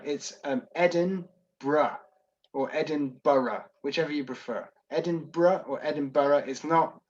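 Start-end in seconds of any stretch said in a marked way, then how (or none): 0.7-2.0 Edinburgh said very slowly as ED-in-bruh, falling at the end; local pronunciation. Shown as a demonstration. Teaching viewers how locals say Edinburgh. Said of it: "Can you explain how to pronounce Edinburgh?". 2.7-3.6 Edinburgh said slowly as ED-in-buh-ruh, four light syllables; alternative pronunciation. Shown as a demonstration. Offering viewers a second acceptable way to say Edinburgh. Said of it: "Can you explain how to pronounce Edinburgh?".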